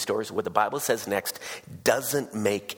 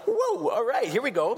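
mock surprise. Acts out his reaction to story.

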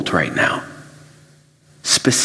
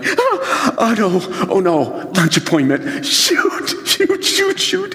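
much higher, laughing oh no; laughing panic; 11 semitones higher, slower than usual. Retold or imitated. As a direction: panic at the missed lunch, laughing at yourself. Forgot lunch appointment.

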